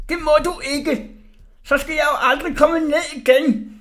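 high, loud panicked protest; desperate shout. A narrator's reading. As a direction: yell it pleadingly from up high. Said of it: "råbte Orla".

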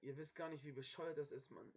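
normal talking voice

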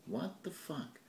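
normal talking voice

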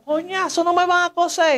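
high-pitched indignant outcry, retold; acted high cry of complaint; exasperated protest. Retold protest while in pain.

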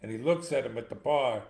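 ordinary talk

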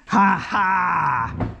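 gleeful high victory laugh; triumphant laugh. Real, not acted. Trunk finally opens after struggle.

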